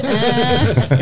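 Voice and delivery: startled high yelp; jolted awake in shock; shocked what. Retold or imitated. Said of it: "I was like".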